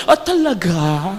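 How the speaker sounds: soft, slow, laughing "Ah, talaga?"; coy pleased reply. Retold or imitated. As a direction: play it flattered and bashful. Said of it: "Sabi ko".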